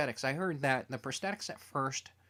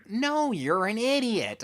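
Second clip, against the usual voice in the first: retold indignant retort; 8 semitones higher, 6 dB louder, slower than usual. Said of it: "And I'm like".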